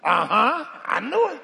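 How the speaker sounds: loud, high mocking "uh-huh"; mock gloating. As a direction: crow like a judgy church member. Mocking imagined church member.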